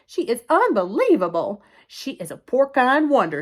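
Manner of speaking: loud, high marvelling; exclaimed praise. Acted. The Watsons praise Mercy for saving them, marvelling. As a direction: proclaim the wonder emphatically.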